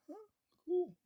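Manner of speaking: high, thin impressed "huh, cool"; mock surprise. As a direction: sound grudgingly impressed.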